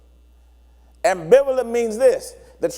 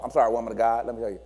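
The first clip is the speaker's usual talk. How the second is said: ordinary talk, nothing marked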